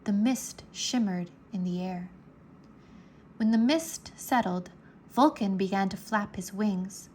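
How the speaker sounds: ordinary delivery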